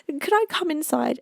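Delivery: squeaky high little voice, pleading and shivering; high, small voice of a freezing snail asking to come in; tiny snail voice. Acted. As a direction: play a tiny cold snail begging at the door. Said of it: "he hears a little voice saying".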